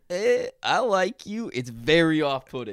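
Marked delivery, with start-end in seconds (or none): 0.1-1.5 high, slow, hesitant mock affection; stilted attempt at being loving; awkward affection. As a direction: stretch it out awkwardly. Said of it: "kinda awkwardly being like".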